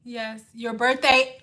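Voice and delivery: ordinary talk, nothing marked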